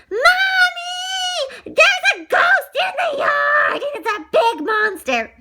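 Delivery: very high, loud panicked cry; frightened scream. A narrator's reading. Little girl reports a ghost in the yard, screaming. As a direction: shriek to Mommy in alarm. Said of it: "she screamed and ran off and said".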